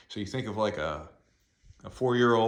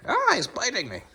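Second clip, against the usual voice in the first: playful yelp; 7 dB louder than usual. Real, not acted. Dog nips playfully.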